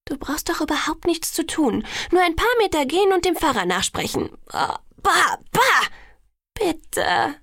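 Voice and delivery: high, insistent pleading; eager coaxing. A narrator's reading. Alice argues the wedding is no work, coaxing. Alice coaxes, begging excitedly.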